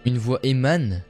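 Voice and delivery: usual speaking voice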